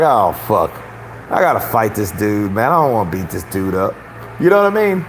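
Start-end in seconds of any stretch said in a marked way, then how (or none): 0.0-3.9 lightly voiced reluctant fighter, low; Anthony Joshua. Host voices the champion sizing up his opponent, mildly reluctant. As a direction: sigh out mild reluctance in a lowered voice. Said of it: "Joshua was looking at this guy like".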